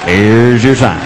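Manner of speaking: low, loud punch on your; punchline catchphrase. Acted. Comic caps the story, low punchy catchphrase. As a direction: land it low and heavy.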